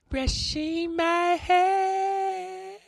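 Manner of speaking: piercingly high, very slow falsetto; silly drawn-out falsetto parody of ASMR; joke ASMR demo. Shown as a demonstration. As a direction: squeal it slowly, swelling then holding the last word. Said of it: "Did you like how that sounded?".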